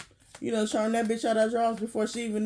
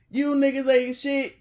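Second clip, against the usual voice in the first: ordinary talk, nothing marked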